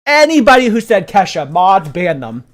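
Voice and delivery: very loud, high-pitched command; escalating indignant shout. Real, not acted. Streamer ordering mods to ban the wrong guessers, shouting. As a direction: shout it harder, like passing sentence.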